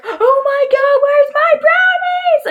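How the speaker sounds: put-on high, slow, whiny boy freaking out; panicked boy. Boy discovering his brownies are gone, high panicked wail. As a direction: wail it like a kid who lost his lunch. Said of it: "he was like".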